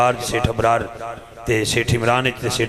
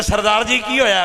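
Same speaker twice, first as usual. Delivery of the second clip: calling out; 7 semitones higher than usual. Acted. Malang calls to stuck Sikh.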